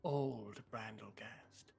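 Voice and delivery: put-on slow, hushed old witch, tasting the air; a lightly played sly crone musing. GM voicing the witch.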